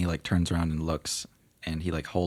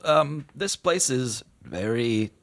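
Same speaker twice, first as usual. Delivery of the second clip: genie voice; 6 semitones higher, 5 dB louder, much slower than usual. Acted. Genie speaks slowly.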